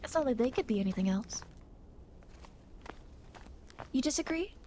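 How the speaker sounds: ordinary delivery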